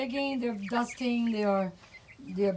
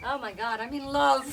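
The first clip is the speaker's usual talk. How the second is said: normal talking voice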